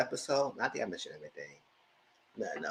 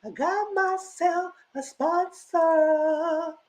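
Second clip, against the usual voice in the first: high, slow mocking singsong; hammed-up high taunting voice; mock sing-song; much slower than usual. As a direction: mimic her bragging, much higher. Mocking Tierra's sponsor performance.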